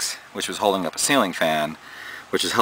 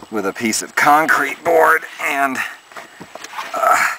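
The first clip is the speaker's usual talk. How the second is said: straining in a cramped attic; grunting with effort; 8 semitones higher, 3 dB louder than usual. Real, not acted. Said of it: "sorry, I'm grunting here".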